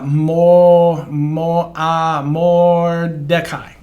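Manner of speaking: mo, mo, then Mordecai spoken slowly; teacher sounding out a name; sounding out syllables. Shown as a demonstration. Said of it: "So we have that ah".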